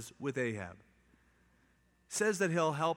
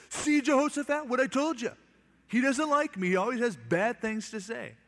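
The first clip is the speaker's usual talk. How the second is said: much higher, louder petulant whine; whiny complaint; 9 semitones higher, 5 dB louder than usual. Retold or imitated. Ahab complains to Jehoshaphat, high petulant whine. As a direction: whine like a sulking child tattling.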